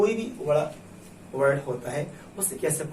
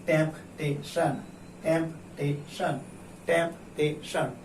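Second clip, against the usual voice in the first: "Temptation" split into temp, tay, shun; slowly, syllable by syllable. Shown as a demonstration. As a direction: sound out "temptation" with a gap after each syllable. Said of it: "उसे धीरे-धीरे पढ़िए".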